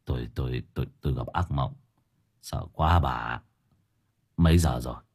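low, soft, halting man's voice; relieved, shaken old husband. A narrator's reading. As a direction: stammer it out low after a nightmare. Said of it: "thở phào một hơi". (giọng ông chồng trầm nhỏ, còn hoảng sau ác mộng)

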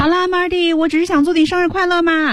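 "我只是想祝你生日快乐嘛" held high and lifted. Shown as a demonstration. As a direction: pitch "快乐" up high. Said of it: "就是这种声音".

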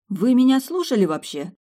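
high, loud, incredulous question; bewildered. A narrator's reading. Heroine challenges the prince jumping up, bewildered. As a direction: demand whether he listened, in disbelief. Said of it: "недоуменно произнесла".

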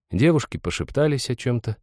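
plain reading voice

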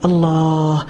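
ordinary talk, nothing marked

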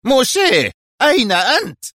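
furious boss bellowing a demand; loud, strained, enraged cry; angry shout. A narrator's reading. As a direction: yell into the phone, very high-pitched. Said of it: "وهو يصيح في غضب".

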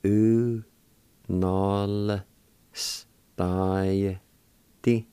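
U, nol, stai, ti, each drawn out separately; syllable by syllable. Shown as a demonstration. Teacher sounding out the word for meals, slow and broken up. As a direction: hold each of U, nol, stai, ti with pauses between. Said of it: "like this".

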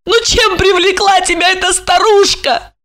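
very high, overwrought pleading; high, anguished woman's voice. A narrator's reading. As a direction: plead at the edge of tears, pitched high. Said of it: "Марина с надрывом продолжила".

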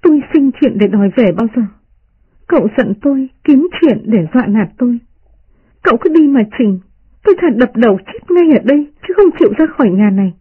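high-pitched aggrieved maid; narrated crying, voiced as wounded indignation without sobs; tearful protest. A narrator's reading. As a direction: protest hotly in a high, hurt voice. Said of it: "Tỉnh Văn khóc lóc".

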